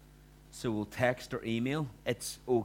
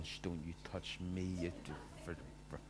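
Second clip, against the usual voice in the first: much softer, low hissed anger through the teeth; hushed angry quarrel; 5 semitones lower, 11 dB quieter than usual. Retold or imitated. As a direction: snap quietly at your spouse so no one hears. Couple quarrelling in the car after church.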